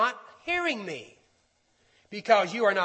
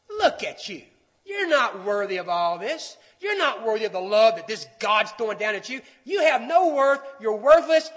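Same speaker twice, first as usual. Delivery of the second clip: accusing liar; 6 dB louder than usual. Acted. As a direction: start firm, end hammering the listener down. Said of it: "The voice of the liar says".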